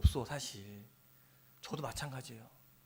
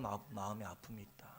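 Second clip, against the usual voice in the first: whisper; 9 semitones lower, 10 dB quieter than usual. Real, not acted. Pastor describing deep grief.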